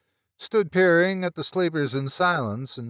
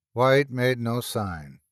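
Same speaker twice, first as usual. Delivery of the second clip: plain reading voice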